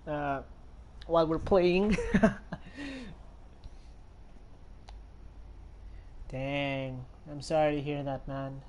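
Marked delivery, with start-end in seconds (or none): 2.0-6.2 long airy laugh. Real, not acted. Laughing after comforting a viewer.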